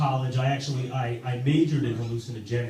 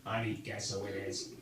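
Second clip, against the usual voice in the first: robotic voice; 5 semitones lower, 11 dB quieter than usual. Retold or imitated. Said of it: "sounds like a guy from Terminator".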